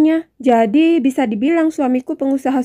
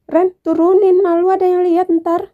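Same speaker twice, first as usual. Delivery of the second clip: higher, louder embarrassed plea; flustered protest; 5 semitones higher, 4 dB louder than usual. Acted. Wife begs Randy to put her down, flustered protest. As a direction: squeal to be put down.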